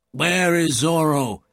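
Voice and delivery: a touch of anger, slow and demanding; mild mock shout at the room; angry shout. A narrator's reading. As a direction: sergeant demanding an answer, understated anger. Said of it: "shouted Gonzalez angrily".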